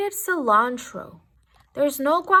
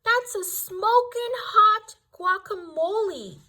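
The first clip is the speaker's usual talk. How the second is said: gasping yell; 7 semitones higher than usual. A narrator's reading. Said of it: "they all hollered".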